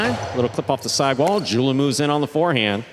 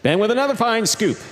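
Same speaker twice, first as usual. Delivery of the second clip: excited play-by-play; 9 semitones higher than usual. Real, not acted. Commentator calls a tough save to viewers, excited play-by-play. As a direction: call the rally with rising excitement.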